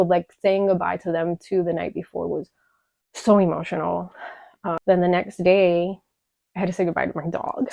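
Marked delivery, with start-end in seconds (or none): none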